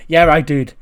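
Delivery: loud, frustrated outburst; snapped. Acted. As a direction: snap back, admitting failure loudly. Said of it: "Sami sort of snapped and goes".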